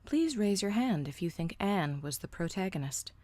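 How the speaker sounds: plain reading voice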